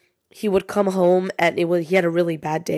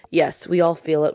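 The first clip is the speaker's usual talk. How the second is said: ordinary talk, nothing marked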